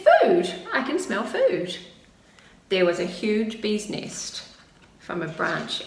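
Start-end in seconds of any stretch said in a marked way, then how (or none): 0.1-1.8 loud high excited cub voice; excited cry. Acted. Little cub calls out to Grandma, loud and excited. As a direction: shout it out with joy.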